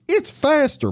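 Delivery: playful catchphrase. Acted. Ad catchphrase, high pitch jump.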